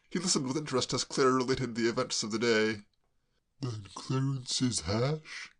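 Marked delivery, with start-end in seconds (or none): 3.6-5.5 a hint of a puzzled gentleman; mildly baffled; lightly voiced Braddock. Braddock puzzled by Claire, mildly baffled.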